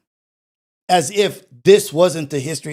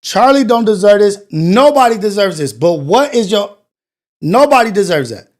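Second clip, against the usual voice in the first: louder, pressing each challenge hard; loud angry rant; 2 semitones higher, 6 dB louder than usual. Real, not acted. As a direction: demand an answer, voice raised. Angry rant at crying clip.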